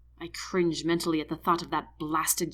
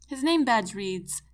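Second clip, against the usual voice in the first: ordinary delivery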